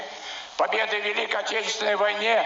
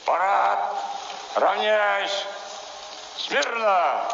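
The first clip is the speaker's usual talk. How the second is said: shouted commands; 4 semitones lower, slower than usual. Real, not acted. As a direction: call the troops to attention.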